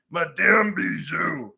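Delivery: slow, hammed-up French accent on the name; mock French accent. Host teasing the chat about a French lady, slow mock French accent. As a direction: drag out Madame Bijou in a put-on French accent. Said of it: "Not when you see Madame Bijou".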